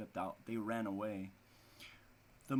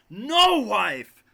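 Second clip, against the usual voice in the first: loud, high cry of refusal; father's outcry; 8 semitones higher, 17 dB louder than usual. Acted. The father refuses his wife, a loud high cry.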